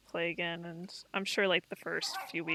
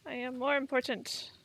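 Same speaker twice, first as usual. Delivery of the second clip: bossy dog voice; 9 semitones higher than usual. Retold or imitated. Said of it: "She's like".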